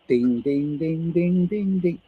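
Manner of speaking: vocal mallet scale going up; imitated xylophone. Shown as a demonstration. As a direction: sing out rising xylophone notes. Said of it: "the xylophone".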